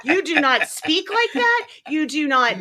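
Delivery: loud, laughing protest; mock disbelief. Calling out Ray's fake Southern accent on a visit, mock disbelief. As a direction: call out the fakery, amused and emphatic.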